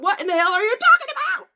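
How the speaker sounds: exasperated outburst. Real, not acted. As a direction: blurt it out in total disbelief. Exasperated at the lady's claim.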